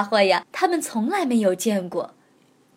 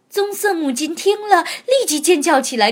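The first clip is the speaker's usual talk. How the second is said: usual speaking voice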